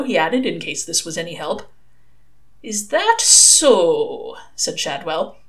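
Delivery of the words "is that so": put-on very slow, drawn-out old man's voice; sceptical. Shadwell answers the young caller, slowly sceptical. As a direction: stretch every word with heavy doubt.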